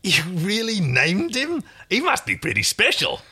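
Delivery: high, slow incredulous question; amazed stallkeeper. Acted. Stallkeeper to Aaron about naming a male, amazed. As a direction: marvel at the very idea.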